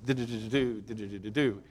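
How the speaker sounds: quick sing-song do-do-do of busywork; busy humming. Retold or imitated. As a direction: hum it like someone bustling round the kitchen. Martha bustling busily.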